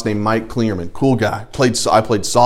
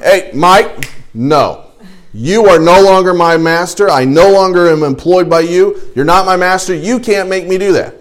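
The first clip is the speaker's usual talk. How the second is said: mock defiant refusal; 5 semitones higher, 5 dB louder than usual. Imagined defiant refusal to old boss.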